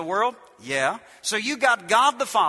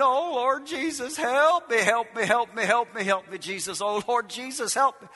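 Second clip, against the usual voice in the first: mock desperate prayer; 3 semitones higher than usual. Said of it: "You've been praying".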